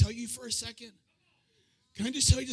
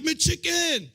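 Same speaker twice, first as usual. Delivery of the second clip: street guy's call; 5 semitones higher than usual. Retold or imitated. Street guy spotting his sandwich giver, high calling out. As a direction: sing out the order like a street regular. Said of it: "he'd be like".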